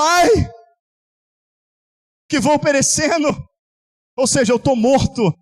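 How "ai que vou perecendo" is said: lament of Isaiah. Acted. Preacher voicing Isaiah before God. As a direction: cry out as the prophet, raised. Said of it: "Quando ele fala".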